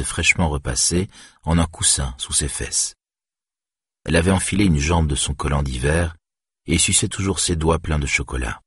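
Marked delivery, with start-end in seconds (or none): none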